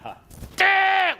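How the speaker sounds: high, squeezed strained cry; demonstrated constipated-sounding straining groan. Shown as a demonstration. Coach showing what people do to their voice, straining. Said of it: "what you're doing to your voice".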